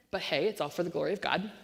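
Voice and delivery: ordinary delivery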